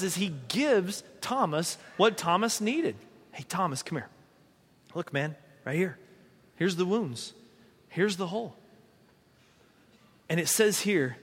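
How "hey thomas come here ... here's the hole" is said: gentle invitation. Retold or imitated. Jesus gently showing Thomas his wounds, soft and tender. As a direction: coax him in quietly and kindly.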